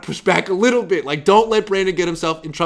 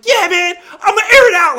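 much higher, shouted with a laugh; mock bro voice, yelled and laughing; hammed-up hype; 10 dB louder than usual. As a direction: belt it out like a cocky young player. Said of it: "Brad was like".

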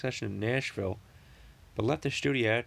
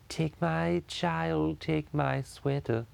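very slow, higher cartoon baby voice; Stewie voice; 5 semitones higher, much slower than usual. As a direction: drag every word like a cartoon baby. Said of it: "sounds good in the Stewie voice".

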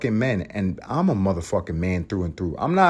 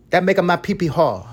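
hammed-up repeat; 7 semitones higher than usual. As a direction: ham it up like a cartoon tough guy. Podcast host repeats crude line.

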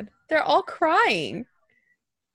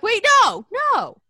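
startled shout; 8 semitones higher, 5 dB louder than usual. Retold or imitated. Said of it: "And I'm like".

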